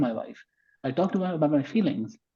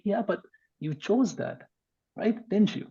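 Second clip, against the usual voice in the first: higher, slow questioning of the friend's choice; pointed challenge; 6 semitones higher, 3 dB quieter, slower than usual. Retold or imitated. Arguing with divorced friend, slow and higher. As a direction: press him gently on his choice.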